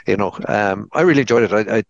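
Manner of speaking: usual speaking voice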